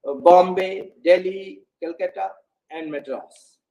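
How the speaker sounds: each city level, then fall on Madras; list with final fall. Shown as a demonstration. Teacher listing cities, falling on Madras to close. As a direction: drop the tone on Madras to end the list. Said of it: "at the last, we can fall our tone".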